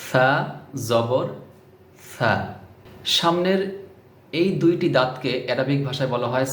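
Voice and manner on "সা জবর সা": সা with the tongue tip touching the upper front teeth, then জবর; careful pronunciation. Shown as a demonstration. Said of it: "আগে লাগাবেন তারপরে বলবেন".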